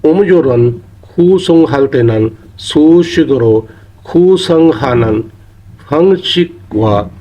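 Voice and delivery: slow, careful Korean reading, syllable by syllable; careful teacher's Korean read-aloud. Shown as a demonstration. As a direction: read out the Korean sentence slowly for the learners. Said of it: "और आगे कहता".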